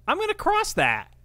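high-pitched mock eager declaration; put-on high voice, falling at the end; mock teenage resolve. Mocking a teen lured onto the bridge, high-pitched eagerness.

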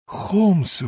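high-pitched cry of sudden realization; startled exclamation. A narrator's reading. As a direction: call out the name, pitch leaping up. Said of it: "вигукнув я".

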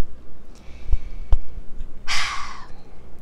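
long unvoiced breath out; deep sigh. Real, not acted. Host pausing after dedicating the video, deep sigh. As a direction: let out a long, heavy sigh.

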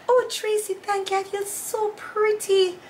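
high, slow, dainty mock mom voice; high mock thank-you from mom; imitating mother. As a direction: preen like mom admiring her hair. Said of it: "This is exactly how she looked".

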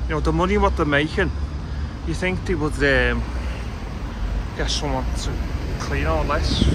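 fast, then loud and high on it's all about the money; indignant rant. Real, not acted. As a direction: rail against the greed.

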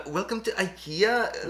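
slow, lightly put-on Swedish accent; drawn-out Swedish ad voice; weird Swedish accent. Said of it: "this really weird Swedish guy with a really weird accent".

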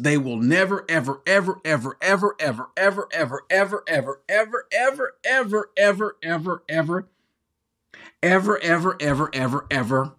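EVER repeated on and on, high-pitched, rolling without a let-up; hugely emphatic. Acted. Preacher quoting the Greek's strongest negation, exaggerated emphatic repetition of ever. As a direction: hammer every "ever" in an endless high chain. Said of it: "in the most emphatic way".

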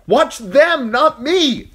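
loud high scream. A narrator's reading. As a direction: scream at the boy. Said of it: "she screamed at Hot Pie".

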